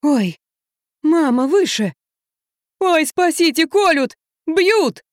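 high, escalating cries for help; panicked. Acted. Drunk man cries out as imagined devils attack, panicking.

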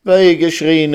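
high, loud wail of dismay; unhappy cry. A narrator's reading. Reisel learns what the word means, crying out unhappily. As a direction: cry out in distress. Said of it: "rief die unglückliche Reisel".

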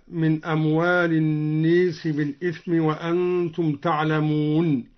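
الناس said "an-nees"; imala on الناس. Shown as a demonstration. Teacher showing another imala, الناس as "an-nees". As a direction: tilt the long a of الناس towards "ee". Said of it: "وكذا أمال قوله تعالى".

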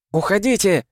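high-pitched urgent yell; shouted command. A narrator's reading. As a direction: cry out an order to the whole crew. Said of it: "крикнула Миас".

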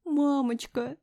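high, plaintive call; tearful, high-pitched cry; sobbing. A narrator's reading. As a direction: whimper for mother. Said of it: "всхлипнула я".

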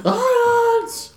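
very high-pitched mock yell; squealed all-caps outburst. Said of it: "Is she yelling it? Is it in all caps?".

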